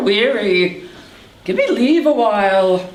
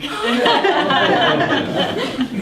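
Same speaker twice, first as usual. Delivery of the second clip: laughing; 4 semitones higher than usual. Acted. Nurse laughs.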